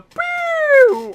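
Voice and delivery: loud, high, drawn-out "pew"; imitated music sting. Fan mimicking the cue for Randy's pose, soaring sting. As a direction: let the pew soar like a music hit.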